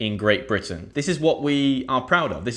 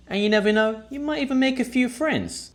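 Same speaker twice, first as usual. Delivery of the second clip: bright teaser; 12 semitones higher than usual. Real, not acted. Cheerful teaser promise to viewers.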